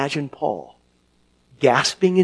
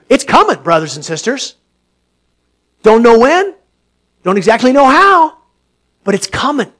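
loud, rising preacher's cry; preaching at full force; 7 semitones higher, 15 dB louder than usual. Acted. Preacher proclaiming to his congregation, loud and soaring. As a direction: lift the room with the promise.

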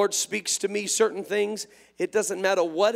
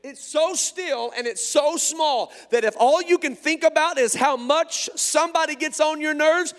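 raised, louder pulpit delivery; full-force preaching; 6 semitones higher, 6 dB louder than usual. Real, not acted. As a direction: preach it hard and high, stretching key words.

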